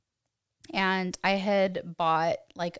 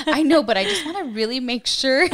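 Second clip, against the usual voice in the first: giggling through the excuse; laughing; 7 semitones higher, 6 dB louder than usual. Retold or imitated. Woman laughing as she answers her husband about the tests. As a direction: laugh while defending herself.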